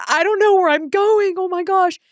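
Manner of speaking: high, panicked cry of being lost; lost driver, half-yelling in panic; frantic. Retold or imitated. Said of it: "like, frantic".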